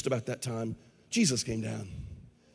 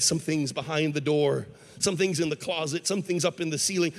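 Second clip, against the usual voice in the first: usual speaking voice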